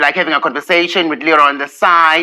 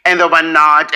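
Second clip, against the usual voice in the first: ordinary talk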